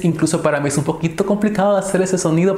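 ordinary delivery

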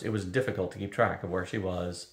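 usual speaking voice

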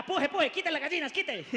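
urgent doctor shout. Retold or imitated. Doctor urging birth.

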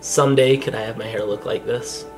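ordinary delivery

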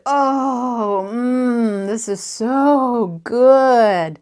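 long, drawn-out blissful mm; loud, slow and swooning over a treat; savouring with relish. A narrator's reading. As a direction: relish every mouthful, stretching the words. Savouring a milkshake in story.

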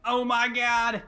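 high, slow, drawn-out amazement; stunned oh my God. Real, not acted. Gamer stunned on entering the rift, high and drawn out. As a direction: let the awe stretch out.

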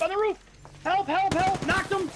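high panicked cries for help; screaming in fear. Real, not acted. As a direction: yelp it out in panic. Under fire in firefight.

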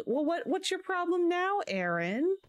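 high-pitched exasperated demand. Real, not acted. Scolding Aaron for saying no, high exasperation. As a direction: pitch it way up in disbelief. Scolding game character who refused.

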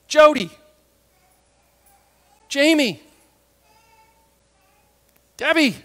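loud, separate name calls; ringing call with long pauses between names. Acted. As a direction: call each person sharply to wake them. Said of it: "Imagine he says".

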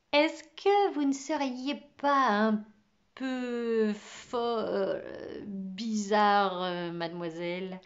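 débit qui ralentit, plein de pauses; hésitation prudente. Acted. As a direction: cherche tes mots avant d'oser le dire. Élève hésite à la dire folle. (question hésitante, mots retenus)

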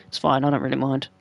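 ordinary talk

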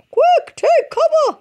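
very high, loud slow shriek; turkey yells to hide; panicked turkey. A narrator's reading. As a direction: shriek the warning and dive.

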